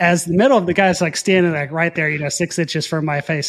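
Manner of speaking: ordinary delivery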